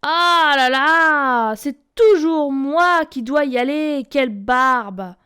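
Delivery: loud, drawn-out grumble; loud fed-up whine; grumbling. A narrator's reading. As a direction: groan it out, fed up. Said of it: "Le petit chaperon noir grogna".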